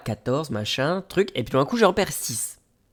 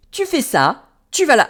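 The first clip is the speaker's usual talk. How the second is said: high-pitched, louder imitation of a helper; lady giving directions; 12 semitones higher, 5 dB louder than usual. Station lady directing a lost traveller, high mimicked voice. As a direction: mimic her brisk orders in a raised pitch. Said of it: "Elle me dit".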